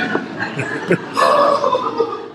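short airy laugh at himself; brief amused breathy laugh; light laugh. Real, not acted. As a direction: chuckle at the joke. Laughs at own rapture joke.

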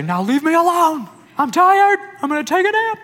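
high-pitched, whiny hammed-up outburst; loud, cranky plea to be left alone; frustrated. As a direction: complain loudly, voice way up high. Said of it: "boil over from the frustration Jesus had".

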